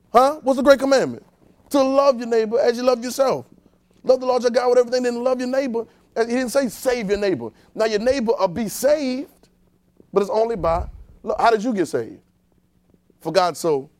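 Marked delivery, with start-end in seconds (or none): 0.1-11.7 high, raised preacher's voice; emphatic preaching. Real, not acted. Preacher quizzing the congregation on the great commandment, emphatic preaching. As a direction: drive the commandment home, pitched high.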